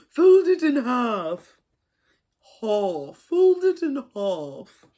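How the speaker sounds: high, loud, drawn-out British; British accent. Acted. Crafter busting out a British accent for fun, hammy British. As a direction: put on a big slow British accent. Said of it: "a British accent".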